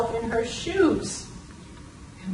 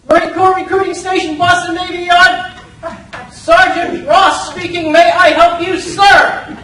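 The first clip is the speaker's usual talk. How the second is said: loud, higher, brisk Marine sergeant on the phone; crisp sergeant; 6 semitones higher, 10 dB louder than usual. Retold or imitated. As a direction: bark it out like a drill-sharp recruiter. Recruiter sergeant answering phone.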